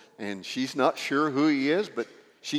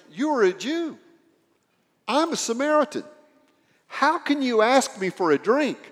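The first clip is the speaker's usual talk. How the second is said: higher, incredulous asking; shocked; 7 semitones higher, 3 dB louder than usual. Retold or imitated. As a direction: voice her disbelief that he spoke to her. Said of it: "she is shocked".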